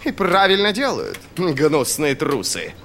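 high, gloating general, drawing out the insult; mocking amusement. A narrator's reading. General scoffs at the enemy before his approving staff, amused. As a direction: crow scornfully at the cowards. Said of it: "засмеялся Полдер".

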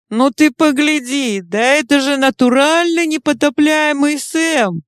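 high, excited yell. Acted. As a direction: holler it out in surprise. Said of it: "заорала Чума".

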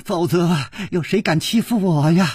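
tearful high-pitched tantrum; hoarse-cried wailing. Acted. Daughter-in-law wailing in father-in-law's arms, hysterical lament. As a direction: wail and lament, voice rising in bursts. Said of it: "嘶声说".

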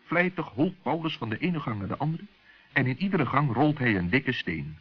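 ordinary delivery

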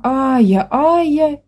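put-on child's high cry of the nanny's name; joyful yell. A narrator's reading. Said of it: "с воплем". (детский радостный вопль)

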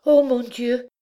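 high, panting with hope; breathless hopeful gasp. A narrator's reading. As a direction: gasp it out full of sudden hope. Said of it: "haletante d'espérance".

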